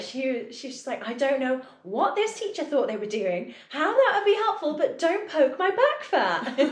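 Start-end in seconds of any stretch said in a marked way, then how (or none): 1.1-6.6 high, loud mock indignation; upset retelling. Team member complains about being poked, imitated as upset and loud. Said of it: "she was so, so upset by it".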